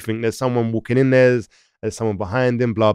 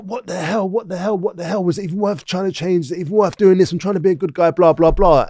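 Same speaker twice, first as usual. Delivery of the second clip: high-pitched, disbelieving inner protest; exasperated; 6 semitones higher than usual. Retold or imitated. Prisoner questioning whether changing was worth it, exasperated. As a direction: start hushed and build in frustration. Despairing thoughts in the cell.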